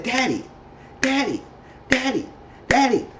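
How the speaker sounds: calling Daddy. Retold or imitated. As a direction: call him like a worried kid. Child calling spaced-out father.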